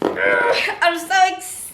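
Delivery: high squeal of excitement, drawn out slowly; sigh, then burst out high and slow; bubbling over with excitement; uncontained excitement. Real, not acted. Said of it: "I can't contain my excitement".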